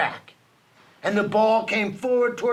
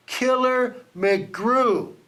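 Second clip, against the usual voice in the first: slow, higher, drawn-out name; dramatic reveal; 5 semitones higher, much slower than usual. A narrator's reading. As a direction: let the name land with menace. Name revealed on villain's jersey.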